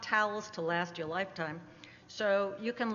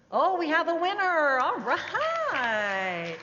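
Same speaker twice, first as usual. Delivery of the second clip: loud, high delighted announcement; excited; 7 semitones higher, 7 dB louder, slower than usual. Real, not acted. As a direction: celebrate the winner. Winner found.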